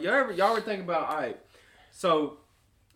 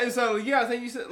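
ordinary talk, nothing marked

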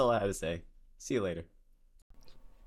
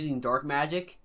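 ordinary delivery

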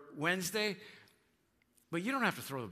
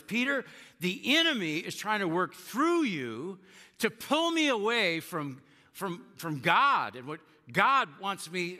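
earnest plea; 5 semitones higher, 6 dB louder than usual. Acted. Jesus voiced explaining to Peter.

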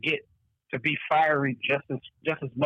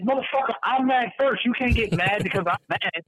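indignant shout; 7 semitones higher than usual. Retold or imitated. To someone mad at you, a mock indignant shout. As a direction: yell that you got mad first. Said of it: "And you're like".

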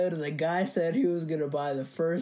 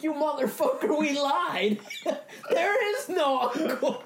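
high, exasperated cry with laughter; mock outrage; 10 semitones higher, slower than usual. Friend asks to bring the uncle, laughing mock outrage. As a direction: protest in a high voice, laughing, last words drawn out.

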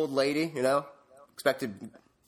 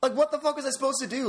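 high, exasperated protest; indignant venting; pissed off; 8 semitones higher, faster than usual. Real, not acted. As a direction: demand an answer in baffled frustration. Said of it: "so fucking pissed off".

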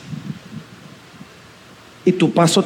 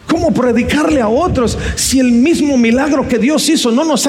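raised, urgent sermon voice; fervent preaching; 5 semitones higher than usual. Acted. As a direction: preach it hard to the congregation.

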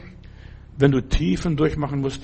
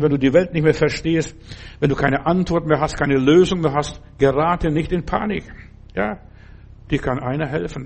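ordinary delivery

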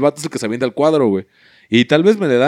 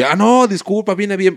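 apologetic friend; 8 semitones higher than usual. Retold or imitated.

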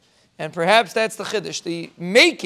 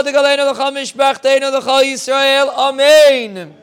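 high, chanted list of blessings building to a loud amen; blessing recitation; 5 semitones higher, 5 dB louder than usual. Real, not acted. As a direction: intone each blessing in a raised, driving litany. Closes with rapid list of blessings.

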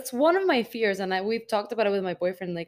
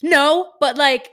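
loud, high defensive protest; 6 semitones higher, 11 dB louder, slower than usual. Retold or imitated. Girlfriend answering boyfriend who says she snapped, defensive. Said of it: "I get so defensive".

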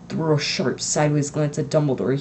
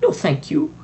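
coldly; 5 semitones higher, 4 dB louder than usual. A narrator's reading. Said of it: "said Professor McGonagall coldly".

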